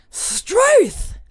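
high startled Aussie exclamation; aussie tradesman. Pretend. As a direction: yelp it like a shocked old bloke. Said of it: "he went".

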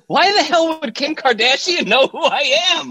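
high-pitched, hammed-up disbelief; incredulous outburst. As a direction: squeal it in mock astonishment. Acts out own stunned reaction.

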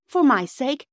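FOR MY SAKE high and loud; desperate plea. A narrator's reading. Phyllis pleads with unconscious boy.

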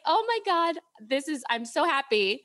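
high-pitched happy disbelief, retold; giddy, high "oh my God"; overjoyed. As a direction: reenact thrilled good news, voice leaping up. Retells getting the Dyson offer.

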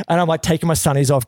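usual speaking voice

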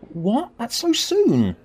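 put-on a boy gasping it out, can't believe it; shocked outburst. A narrator's reading. Tim hears his best friend leaves soon, shocked high cry.

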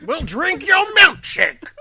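high, slow, hammy villain; drawn-out high villain line; old-time movie villain. Pretend. Quoting a film villain.

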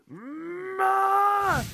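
long high sustained wail, loud; mock scream. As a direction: imitate a man screaming as he runs off. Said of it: "he's just like".

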